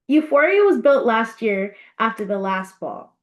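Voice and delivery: ordinary delivery, nothing marked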